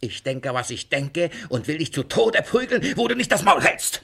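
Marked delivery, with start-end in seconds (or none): 1.5-4.0 building louder and higher to a roar; angry threat. Acted. Master threatening to beat his servant, furious build. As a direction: threaten to beat him, rising in fury.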